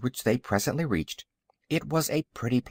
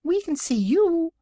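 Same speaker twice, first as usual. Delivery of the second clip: very high-pitched little creature; small squeaky piglet; tiny piglet voice; 4 dB louder than usual. A narrator's reading. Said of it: "said another piglet".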